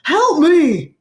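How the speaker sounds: high, loud cry for help; panicked shout. A narrator's reading. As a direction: yell for help over the chaos. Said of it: "and Otis shouted".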